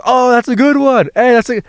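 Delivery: gleeful high-pitched friend laughing at me; homies cheering. Retold or imitated. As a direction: crow like his laughing friends. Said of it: "And his homies goes".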